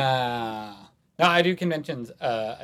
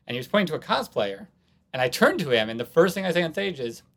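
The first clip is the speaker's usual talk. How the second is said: ordinary delivery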